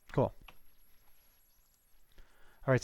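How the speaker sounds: ordinary talk, nothing marked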